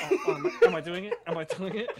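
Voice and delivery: lightly mocking wife's high, loud retort; high, loud mock-indignant partner; mock Boston attitude. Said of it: "this Boston attitude".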